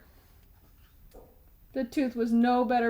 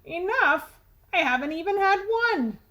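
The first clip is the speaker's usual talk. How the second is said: loud tooth; 7 semitones higher, 5 dB louder than usual. Acted. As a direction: blurt it across the classroom. Tooth protests.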